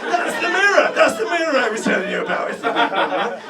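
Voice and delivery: high, loud, fast proud yelling; thrilled bragging shout; excited shout. Retold or imitated. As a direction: show off the mirror, bellowing. Acted self showing off mirror.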